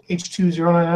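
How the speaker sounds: light robotic droid voice. Shown as a demonstration. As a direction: read it like a droid. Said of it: "Sounds like a droid".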